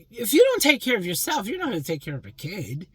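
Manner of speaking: loud, high scold; scolding shout. Real, not acted. Woman scolds deadbeat dads, loud and high. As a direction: bark the warning at them.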